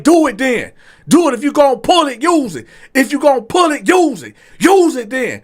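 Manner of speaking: high, loud escalating challenge; shouted dare. Retold or imitated. Diner shouting at the waiter holding a knife, high and loud. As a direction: dare him to use the knife.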